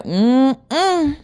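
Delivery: high-pitched knowing hum; imitated ancestor's murmur; mama spiritualist. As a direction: hum like an impressed hoodoo elder. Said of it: "She kinda talked like that".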